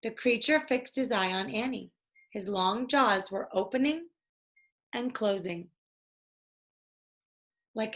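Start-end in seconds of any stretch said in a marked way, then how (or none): none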